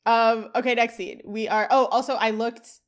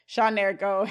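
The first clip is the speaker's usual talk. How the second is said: normal talking voice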